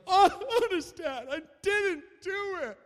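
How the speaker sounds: high, loud mock sobbing kid; imitated crying. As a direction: wail it like a boy in tears, hammed-up. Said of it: "Riley bursts into tears".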